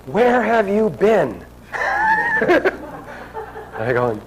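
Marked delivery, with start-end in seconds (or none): 0.1-1.4 high, slow scolding cry. Retold or imitated. Old woman scolding the late visitor, high and slow. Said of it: "she starts scolding me". 1.7-2.4 laugh. Real, not acted. Laughing at the memory.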